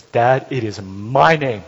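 loud, slow insistence; insistent son. Retold or imitated. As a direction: plant your feet and insist.

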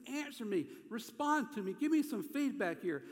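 high, insistent appeal; pleading. Acted. David hurting, wanting God's response, pleading. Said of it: "he's just pleading".

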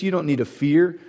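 normal talking voice

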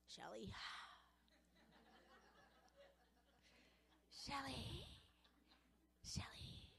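a hint of a devil calling her name, soft to whispered; devil luring. The devil stalking a woman, calling her name in a soft hiss. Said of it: "He just goes".

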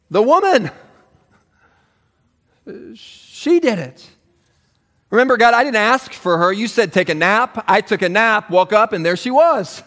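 mock protest from a guilty Adam; whiny Adam. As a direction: blame her like a sulky child. Adam voiced blaming Eve.

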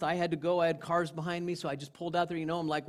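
ordinary delivery

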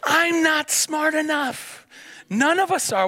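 high, slow, emphatic declaration; emphatic preaching. Real, not acted. Pastor telling the congregation, emphatic high preaching. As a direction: preach it slowly from high up, pressing the point.

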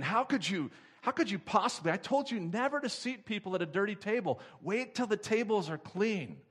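hammed-up chewing out; mock angry manager. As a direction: scold the waitress like a petty boss. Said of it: "just chewing this waitress out".

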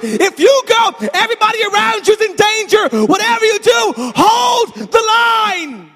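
high, loud battlefield rallying cry; impassioned roar, key words stretched out. Retold or imitated. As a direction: shout at full force to rally fleeing men. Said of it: "he's crying out".

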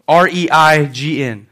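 ordinary delivery

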